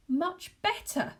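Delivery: high, slow shuddering question; shuddering disbelief. Acted. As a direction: shudder through it. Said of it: "Joe shuddered".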